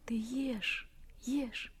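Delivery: soft unvoiced urging to eat; hushed coaxing. Anka whispers to Vanyushka to eat. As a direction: whisper it gently, like feeding him.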